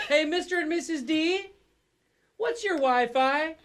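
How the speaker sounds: put-on high young teen babysitter voice; thirteen-year-old babysitter voice. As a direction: play a teen girl babysitter. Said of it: "that's me doing a thirteen-year-old babysitter voice".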